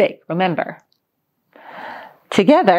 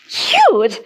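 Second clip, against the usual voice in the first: incredulous Grandma; 5 semitones higher, 4 dB louder than usual. A narrator's reading. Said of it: "replied Grandma".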